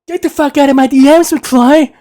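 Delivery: high, loud bully yell; hammed-up movie bully throwing someone out; Biff Tannen. Movie bully chasing friends from his messages, loud high yell. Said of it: "Biff Tannen from, uh, Back to the Future".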